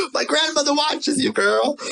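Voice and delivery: repeating it through laughter; high, laughing repeat. Real, not acted. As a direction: crack up while saying it.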